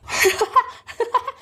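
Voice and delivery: short high cackle; high giggling laugh. Acted. Laughing at the boy getting beaten, high giggle. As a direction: burst of high-pitched laughter. Laugh after the beating cries.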